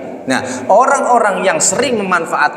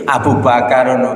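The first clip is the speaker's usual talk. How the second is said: ordinary talk, nothing marked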